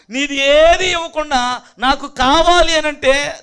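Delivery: insistent pulpit delivery; emphatic preaching. Real, not acted. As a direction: exhort the congregation firmly. Preaches at full force.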